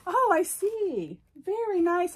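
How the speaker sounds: loud, high "oh I see" after a laugh; amused outburst. Real, not acted. Reading a friend's teasing card, loud amused burst.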